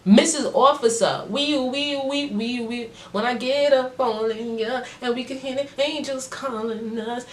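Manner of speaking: high, silly sing-song to the officer; mock flirty reply. Telling the officer a joke come-on, silly and high. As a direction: tease her with a goofy come-on. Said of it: "I'm like".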